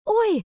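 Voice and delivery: high, loud little cry of pain; pained yelp. A narrator's reading. Girl kicked hard in the shin, pained yelp. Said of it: "вскрикнула я".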